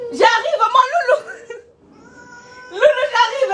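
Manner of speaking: a loud, high-pitched call to the little one. Real, not acted. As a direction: shout across the house that you're coming. Calling out to her little brother.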